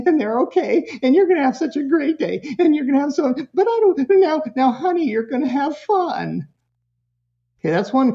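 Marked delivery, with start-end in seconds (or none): 0.1-6.5 rising higher and tense, overselling the fun; nervous over-reassuring mom losing composure; anxious, pleading parent. Retold or imitated. Guilty mom at drop-off pleading with her crying child, pitched up and anxious.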